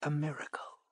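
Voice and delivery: a soft whispered miracle. A narrator's reading. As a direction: whisper it like a secret. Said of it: "she whispered".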